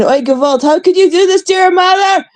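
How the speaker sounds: high, wailing guilt trip. Retold or imitated. Parents shame their kid at the scale, high wailing guilt trip.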